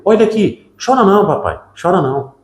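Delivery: consolo infantil imitado, bem mais agudo; voz de criança. Retold or imitated. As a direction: console o pai como uma criança pequena. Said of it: "ela começou a dizer". (menina consolando o pai falecido, voz de criança aguda)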